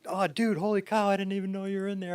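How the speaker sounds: high, loud, hurried imitation of flustered surprise; startled friend. Friend caught cleaning up by people sleeping there, imitated startled. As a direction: blurt it high and fast, imitating someone caught off guard. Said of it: "Joe's like".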